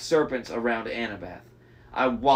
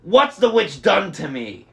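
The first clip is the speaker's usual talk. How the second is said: bellowing; 5 semitones higher, 7 dB louder than usual. A narrator's reading. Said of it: "bellowed the big man".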